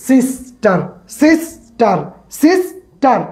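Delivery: "Sister" broken in two, a gap before "টার"; split into two syllables. Shown as a demonstration. Teacher showing learners two-part words, "sister" split at the syllable break. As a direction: say "sister" as two separate beats, সিস — টার. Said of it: "দুইবারে উচ্চারণ হবে".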